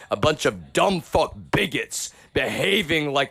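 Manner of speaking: real contempt, voice high and strained; angry rant. Real, not acted. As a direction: spit out contempt at the bigots.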